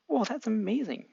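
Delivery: impressed Izuku. Acted. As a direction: gush with admiration. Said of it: "Izuku praising Kopen".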